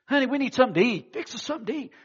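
fast, loud, bossy order to his wife; demanding husband. Retold or imitated. A husband ordering his wife to cook at night, fast and bossy. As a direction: bark it like he owns the house. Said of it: "she comes to the door".

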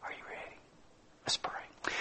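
barely audible whisper; whispered appeal. Real, not acted. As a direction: whisper it as an invitation to prayer.

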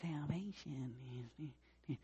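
a flat, very soft murmur; quiet wrong way. Shown as a demonstration. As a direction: mumble it without passion to show how not to. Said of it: "no, it's gonna be loud".

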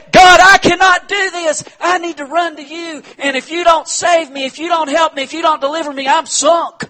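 high, loud pleading prayer; a pastor acting out a desperate shouted prayer; desperate cry to God. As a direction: cry out for rescue. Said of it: "you say".

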